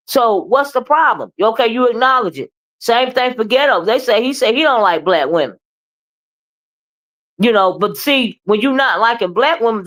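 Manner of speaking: ordinary talk, nothing marked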